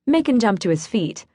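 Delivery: usual speaking voice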